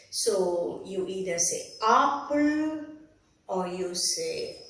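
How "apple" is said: "apple" with a long, open "aa" and drawn-out ending; regional pronunciation. Shown as a demonstration. Teacher showing a wrong regional way, long-vowelled "apple". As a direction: say "apple" like "aap-pull". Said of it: "there are strong regional intonations".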